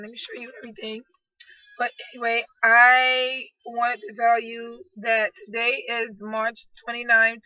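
ordinary talk

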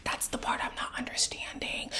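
almost whispered, voice nearly gone; hushed aside. Real, not acted. Host puzzling over why he slept with her, hushed. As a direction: let it sink into a whisper. Baffled aside after pointed question.

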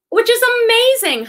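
high excited pitch on amazing. Real, not acted. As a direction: gush about the great find. Thrilled about J Crew box.

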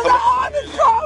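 crying in pain. Real, not acted. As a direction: cry out the words in real pain. Arrested woman in pain.

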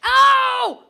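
very loud, high startled "oh"; sudden loud yelp. Real, not acted. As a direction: burst out with a headphone-blasting cry. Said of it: "Sorry, headphone users".

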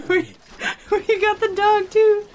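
high sing-song voice for a pet; talking to a dog. Real, not acted. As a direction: coo at the dog. Talking to her dog Henry.

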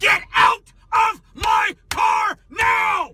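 screaming, slow and high; berserk rage. Real, not acted. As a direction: yell each word at her. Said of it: "Why are you yelling at me?".

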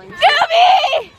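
loud high call across distance. Real, not acted. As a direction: shout her name to get her attention. Calling out to a friend.

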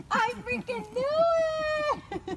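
very high, stretched-out joyful cry; real high-pitched elation; excited squeal. Real, not acted. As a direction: squeal it in triumph, drawing it out. Cheering at a reveal.

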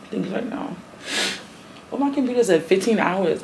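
ordinary talk, nothing marked

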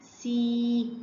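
"سي" with a held ee; sustained phonics "سي"; long vowel. Shown as a demonstration. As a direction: stretch the ya in "سي" evenly. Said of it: "هيبقى صوته إيه".

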